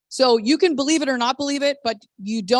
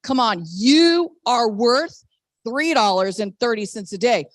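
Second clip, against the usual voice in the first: usual speaking voice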